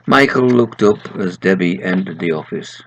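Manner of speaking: steady storytelling read; story reader voice; story narration. A narrator's reading. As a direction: read it like a bedtime tale. Reads his story aloud.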